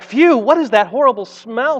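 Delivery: hammed-up, high-pitched revulsion; crying out. A narrator's reading. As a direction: exclaim it in storybook disgust. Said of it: "she cried".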